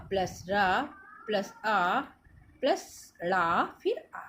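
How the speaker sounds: slowly sounding each letter apart; slow spelling out. Shown as a demonstration. Teacher spelling out a syllable for pupils, slowly. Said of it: "अब ये प्र कैसे बना है?".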